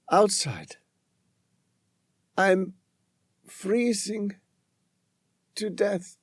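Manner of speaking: high, faint, pleading woman's voice; weak pleading woman. Acted. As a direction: plead weakly, near the end. Trapped outside, freezing.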